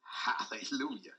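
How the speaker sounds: slow breathy whisper to himself; hushed private praise; whispered hallelujah. Real, not acted. Preacher's aside under breath.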